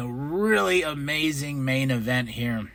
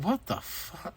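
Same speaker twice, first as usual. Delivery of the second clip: quietly baffled wife; 13 dB quieter than usual. Retold or imitated. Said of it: "She's like".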